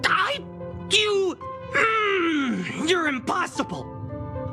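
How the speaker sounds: drawn-out frustrated outburst, falling in pitch; exasperated. Acted. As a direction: sputter high, then growl in frustration. Frustrated by knight's stubborn refusal.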